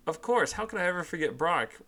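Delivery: playful high mock-innocent question; teasing. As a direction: needle her with a sly grin. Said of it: "Ash replies teasingly".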